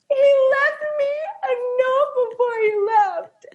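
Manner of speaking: high, wailing teary voice; imitated crying. Friend imitates Taylor sobbing over a note, high and wailing. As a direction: blubber it out through happy tears. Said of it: "And she's like".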